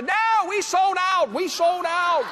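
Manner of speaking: high, drawn-out mock holler; hammed-up jubilant high call; gleeful announcement. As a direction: crow it slowly to the customer. Retold gleeful shout to a customer.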